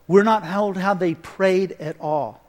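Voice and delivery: usual speaking voice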